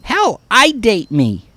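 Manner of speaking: plain reading voice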